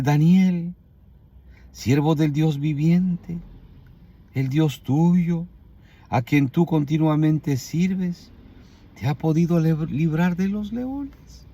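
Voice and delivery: slow, weak, sorrowful call; triste, muy débil. Acted. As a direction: drag each phrase out feebly, rising at the end. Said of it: "con una voz muy, muy ... débil".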